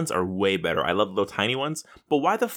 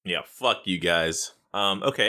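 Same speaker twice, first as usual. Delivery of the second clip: usual speaking voice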